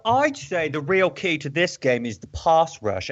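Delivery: put-on slow British accent, Cockney turning educated; Cockney British sliding educated. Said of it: "switched from Cockney British to, like, educated British".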